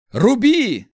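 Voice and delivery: high, loud urgent order; shouted command. A narrator's reading. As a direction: command at full voice. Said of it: "скомандовал он". (громкий приказ криком)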